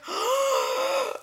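high awed gasp; mock gasp. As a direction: gasp as if life changed. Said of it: "and I was like".

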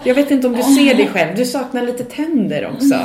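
mocking comeback. Singer snaps back at heckler.

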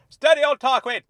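high, very loud rebuke; snapping. A narrator's reading. Dennis checking Tarquin over a personal question, snapping. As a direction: cut him off loudly, then apologise for him. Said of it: "Dennis snapped".

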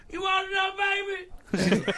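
high mock old-lady offer; imitated ferry lady. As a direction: put on her high-pitched, rowdy voice. Voices the woman offering food.

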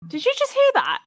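high, sudden startled question. Real, not acted. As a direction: jump at a strange noise. Sudden noise interrupts recording.

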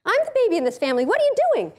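put-on high, indignant toddler protest; little kid. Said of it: "your little kid is going".

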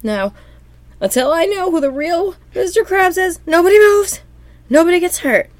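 crazed, high-pitched standoff threat; unhinged high cartoon voice; SpongeBob. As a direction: threaten the room in a loud cartoon squeal. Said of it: "He's really crazy".

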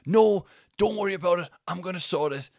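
high, loud brush-off; retold angry shout. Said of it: "he's shouting at us".

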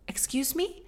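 высокий вежливый оклик; polite call. Retold or imitated. Polite mom calling over fence.

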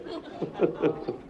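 soft breathy burst of laughter; real laugh. Real, not acted. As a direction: laugh quietly at the memory. Teller breaks into laughter.

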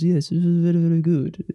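a hint of a posh old gent. Shown as a demonstration. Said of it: "I was doing posh voice".

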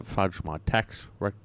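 normal talking voice

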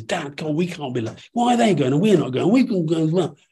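lightly voiced daughter pleading to go too; imitating teenage daughter. A dad voicing his teenage daughter begging to join, mock whiny.

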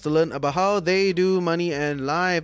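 upbeat show opening; bright host welcome over music; podcast host intro. Acted.

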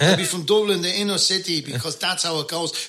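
broad inner-city Dublin accent, lifted pitch. Shown as a demonstration. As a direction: talk like a Dublin local chatting to a mate. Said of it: "I'd be talking like this".

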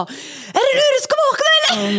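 very high, strained screech; mock yell. Mother shouting through baby monitor at sleeping partner, screechy yell. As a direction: yell it drawn out to wake a sleeper.

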